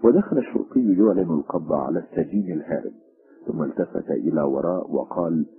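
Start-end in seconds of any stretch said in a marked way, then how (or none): none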